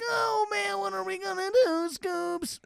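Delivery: high, shouted cartoon panic; panicking Shaggy. Showing how Shaggy sounds when panicking, high shout. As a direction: yelp it like a scared cartoon stoner. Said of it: "only when he's, like, panicking".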